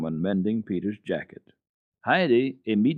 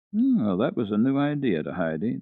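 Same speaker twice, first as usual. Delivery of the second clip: ordinary delivery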